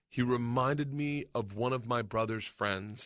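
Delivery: slow, measured storyteller; careful retelling. Retold or imitated. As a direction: set the scene carefully, word by word. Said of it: "she's gonna clean it up".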